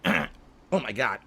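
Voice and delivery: slow, strained through a blocked throat; clearing throat. Real, not acted. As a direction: talk around a throat clear. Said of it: "Trying to clear my throat here".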